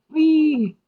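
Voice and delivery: high, delighted little squeal; playful high whee; gleeful whee. Real, not acted. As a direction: celebrate the colorful output. Program output appears, delighted.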